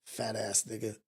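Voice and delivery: a low, soft put-down under the breath; muttered insult. Retold or imitated. Man sneering at his own mirror reflection, muttered low and soft. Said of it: "Look at myself".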